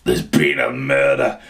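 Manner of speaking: low, slow, dramatic detective voice; mock detective. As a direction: deliver it like a grim TV detective.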